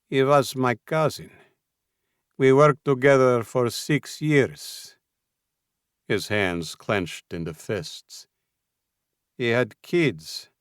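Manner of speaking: put-on slow, contained anger, thick Russian accent; simmering anger. A narrator's reading. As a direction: hold the anger just under the surface. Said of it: "anger simmering just beneath the surface".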